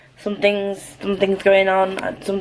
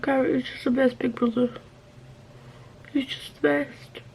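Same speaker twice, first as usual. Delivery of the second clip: doting gush; 7 semitones higher, 7 dB quieter than usual. Real, not acted. Gushing over favourite character.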